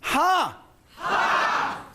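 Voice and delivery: high, hammed-up cry of the word "Ha"; enthusiastic Chinese word. Shown as a demonstration. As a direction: belt out "Ha" with mock commitment. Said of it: "do it with commitment, enthusiasm".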